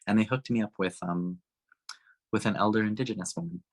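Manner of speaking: ordinary talk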